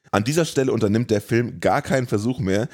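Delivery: ordinary talk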